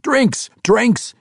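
very high, loud yell. Acted. King Emrys calling for celebration drinks, yell. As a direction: call for drinks across the hall. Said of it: "he yelled".

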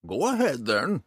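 egging on a hesitant pupil; prompting old ranger. A narrator's reading. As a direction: prod him onward in character. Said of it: "the ranger prompted".

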